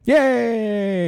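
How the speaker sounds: high, loud happy yay; excited cheer. Real, not acted. Cancer-free news cheered.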